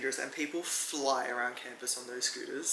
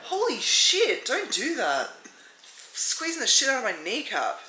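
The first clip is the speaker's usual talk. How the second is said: high, loud outcry of pain; real hurt, yelling at the culprit; pained protest; 8 semitones higher, 6 dB louder than usual. Real, not acted. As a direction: protest loudly, voice shot up in pain. Real pain from squeezed kneecap.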